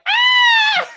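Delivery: very high, loud imitated shriek; hammed-up scared scream; mock shriek. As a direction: shriek like a startled man on video. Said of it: "he's like".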